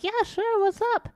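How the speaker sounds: bright, high eager friend; chirpy friend voice. Retold or imitated. Friend eagerly invites her to rant. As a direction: sound keen to listen.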